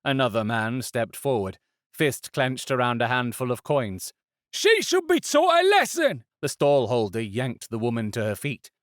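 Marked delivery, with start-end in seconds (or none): 4.5-6.2 high loud demand; angry onlooker shouting; harsh crowd voice. A narrator's reading. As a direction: demand punishment. Crowd member demands punishment.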